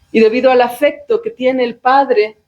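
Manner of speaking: ordinary delivery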